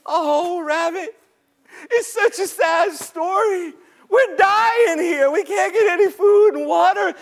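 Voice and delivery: high, plaintive whine; sad, afraid creatures. Acted. Starving creatures complain to Rabbit, high and woeful. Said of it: "looking so afraid and so sad".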